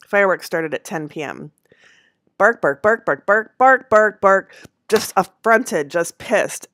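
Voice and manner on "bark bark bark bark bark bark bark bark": loud mock barks at a higher pitch; hammed-up angry dog barks; imitated barking. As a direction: bark like an outraged dog.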